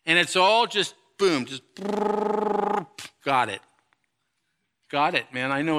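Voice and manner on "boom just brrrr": fast rolling brrr noise; playful rolling lips sound; mock brrr. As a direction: rattle it off like a machine. Mimics rapid info intake.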